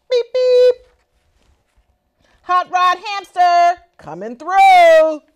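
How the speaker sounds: high, loud racing call; loud beep beep. Acted. Hamster zooming past racers, loud and high. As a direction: shout it as you zoom past.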